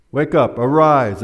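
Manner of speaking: a firm, loud wake-up call; forceful command. Retold or imitated. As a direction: command the girl to rise. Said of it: "spoken forcefully and loudly".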